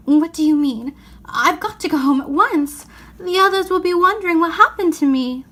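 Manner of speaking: urgent, a touch panicky; frightened. Acted. Lucy anxious to get home, high and urgent. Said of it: "feeling rather frightened".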